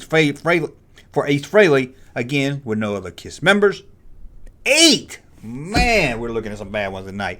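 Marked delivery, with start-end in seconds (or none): 4.6-5.2 excited score call. Acted. Announcing bully-meter score.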